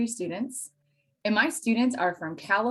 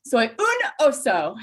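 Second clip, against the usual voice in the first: slow, louder proud Spanish; 5 semitones higher, 6 dB louder, slower than usual. Retold or imitated. Proud child to mom: slow, louder Spanish sentence. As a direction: announce it proudly to mom, slowly.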